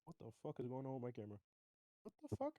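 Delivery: usual speaking voice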